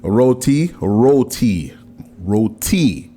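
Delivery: roti with a sharp aspirated T, said over and over; crisp T in roti. Shown as a demonstration. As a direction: drill "roti", hitting the T hard. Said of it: "emphasize the T".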